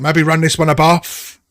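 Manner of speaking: loud, high Cockney quip; Cockney accent. Acted. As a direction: bark it out in Cockney. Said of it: "doing my dreadful Cockney accent".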